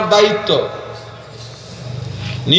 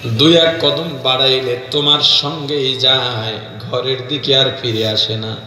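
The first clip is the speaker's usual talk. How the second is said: low, plaintive sing-song narration; melodic preaching; 5 semitones lower than usual. Real, not acted. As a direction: intone the bride's hardship low, lingering on endings. Preacher intones bride's story. (নিচু করুণ সুরে ওয়াজ)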